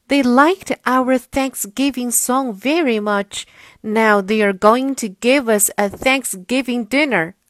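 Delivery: a delighted barnyard turkey; happy turkey voice. Acted.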